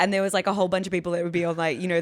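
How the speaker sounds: ordinary talk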